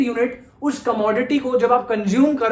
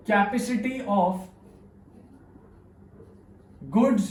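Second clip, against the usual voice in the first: very slow with long pauses between phrases; slow dictation; 5 dB quieter, much slower than usual. Shown as a demonstration. Teacher dictating the definition to students, slow and spaced. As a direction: dictate it word by word so students can write. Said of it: "teacher dictating a definition".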